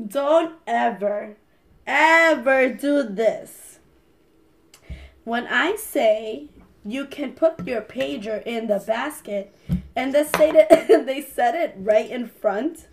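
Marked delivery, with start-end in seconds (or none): 0.1-9.5 very slow, stressed warning; slow emphatic. Real, not acted. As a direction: spell it out slowly, stern. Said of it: "slow emphatic instruction".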